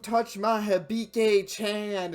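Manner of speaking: slow, loud hammed-up outrage; mock anger. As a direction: ham up a jealous rage. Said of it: "be like, get mad".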